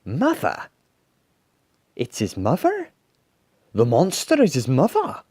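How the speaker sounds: astonished crowd voices; amazed. A narrator's reading. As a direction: question it in disbelief, climbing in pitch. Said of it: "Everyone stared in amazement".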